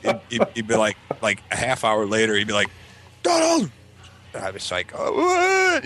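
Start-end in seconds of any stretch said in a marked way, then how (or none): 3.2-3.7 high-pitched summoning yell; dad calling out. Retold or imitated. 4.9-5.8 put-on high, exasperated childish cry; kid calling back.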